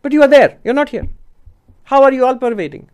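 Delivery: high-pitched challenge, loud at the start; objecting monk. Retold or imitated. Monk challenging the all-pervading teacher, high-pitched objection. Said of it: "the monk at the back of the hall asked".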